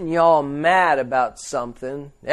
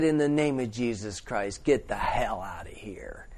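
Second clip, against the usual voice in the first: hushed command; 6 semitones lower, 12 dB quieter than usual. Retold or imitated. Preacher retelling how he whispered the man out of the pub. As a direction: breathe the command quietly but firmly.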